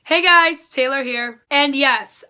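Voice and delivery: usual speaking voice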